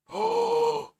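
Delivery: sudden high gasp of shock; sharp gasp. Real, not acted. Streamer gasps at the screen. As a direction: gasp in surprise.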